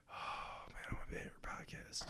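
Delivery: fast, breathy whispered begging; whispered mock plea. Friend recalling his wish to join the podcast, whispered. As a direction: hush it out like a secret wish.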